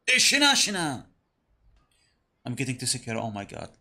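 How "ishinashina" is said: higher, louder playful cry; excited shout. Acted. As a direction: belt out the name with glee.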